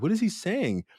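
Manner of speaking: high-pitched retold bafflement; puzzled. Student stuck at a silent retreat, baffled. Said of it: "I was, like, kinda getting stuck".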